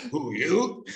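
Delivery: high laughing mock guard. Retelling the guard's disbelief, laughing. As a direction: laugh through the guard's line. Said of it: "retold punchline".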